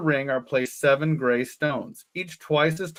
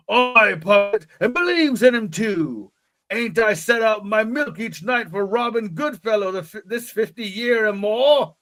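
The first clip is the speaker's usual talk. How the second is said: hammed-up country accent; rustic old shepherd accent; 5 semitones higher than usual. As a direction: speak as a rural English old man. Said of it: "that funny accent all the old people".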